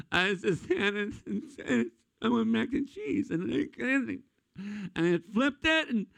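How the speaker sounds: put-on high, slow, whimpering child's voice; tearful little kid. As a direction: blubber it out like a scared five-year-old. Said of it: "it came out something like this".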